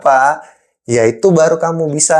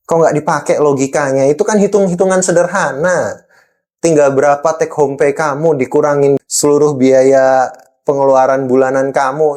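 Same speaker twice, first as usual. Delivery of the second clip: ordinary talk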